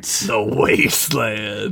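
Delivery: slow, drawn-out doom voice; slow grim aside; ominous mock doom. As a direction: pronounce exile like a movie villain. Dramatic banishment joke.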